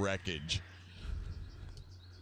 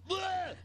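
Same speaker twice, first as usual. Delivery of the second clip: high-pitched mock vomit noise; imitated retching. Retelling the second round of vomit, imitated retch. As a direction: blurt out a comic throw-up sound.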